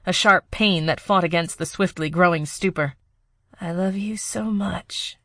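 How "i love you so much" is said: slow breathy whisper of love. Acted. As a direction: whisper it slowly, fading. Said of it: "she whispered".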